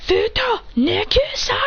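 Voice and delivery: high, slow battle cry; attack call. Retold or imitated. A ninja shouting his attack at his foe, high and slow. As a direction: stretch out the attack name.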